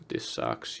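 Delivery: breathless whisper. Retold or imitated. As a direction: let it die into a whisper. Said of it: "You're just like".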